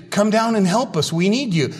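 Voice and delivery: high, pleading call from below; calling for help. Retold or imitated. Trapped victims calling up to the rescuers, mild pleading call. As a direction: call up for rescue, a touch of urgency.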